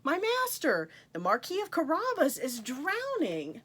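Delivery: high, urgent cry for help; shouting for help. Acted. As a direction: yell it out in alarm.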